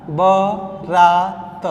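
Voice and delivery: बारात drawn out slowly; slow dictation. Shown as a demonstration. Teacher giving the correct form, slowly. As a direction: stretch बारात syllable by syllable. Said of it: "बारात नहीं होता है".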